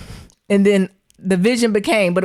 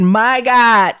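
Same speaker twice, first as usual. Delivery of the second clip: "My God" said out loud, much louder; exclaimed aloud; 2 semitones higher, 6 dB louder than usual. Retold or imitated. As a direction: let "My God" burst out in alarm. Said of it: "I said".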